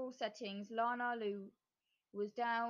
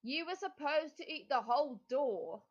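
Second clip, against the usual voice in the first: witch voice; 4 semitones higher, 3 dB louder than usual. A narrator's reading. Witch scolds Lana Lou.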